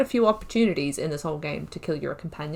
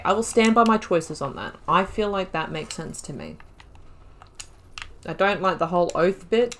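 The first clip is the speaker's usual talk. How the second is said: usual speaking voice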